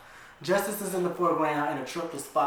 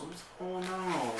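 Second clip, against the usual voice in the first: soft, slow, just-woken mumble; sleepy mumble; 3 semitones higher, 8 dB quieter, slower than usual. Acted. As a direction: mumble it half-asleep. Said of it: "she mumbles".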